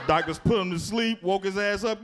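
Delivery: ordinary talk, nothing marked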